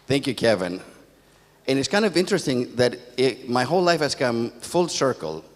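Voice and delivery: normal talking voice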